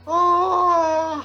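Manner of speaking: high drawn-out fake yawn; host's hammed-up yawn; mock yawn. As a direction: yawn loudly to hint at sleep. Host yawns at lingering guest.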